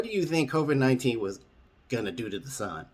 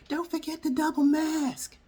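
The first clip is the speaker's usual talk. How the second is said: much higher, slow, squeaky shouted nag; mock yelling Fauci. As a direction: shriek the reminder out the window. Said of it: "he's yelling".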